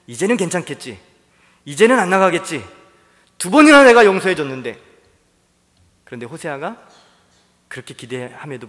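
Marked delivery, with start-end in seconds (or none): 0.1-4.8 rising hopeful plea. Acted. Hosea's hopeful inner voice.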